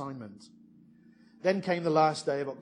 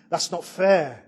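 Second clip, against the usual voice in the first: loud protest shout; retold scream; 9 dB louder, slower than usual. Shout that it isn't fair. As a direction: students howling in protest at the professor, a loud outcry.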